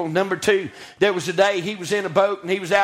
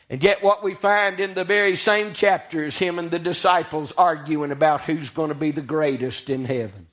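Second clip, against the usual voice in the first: normal talking voice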